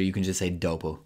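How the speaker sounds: ordinary talk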